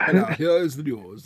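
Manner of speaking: slow, loud posh British newsreader; hammed-up posh British announcer voice; all posh. Shown as a demonstration. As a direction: put on a plummy posh English accent. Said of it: "I was gonna go all posh".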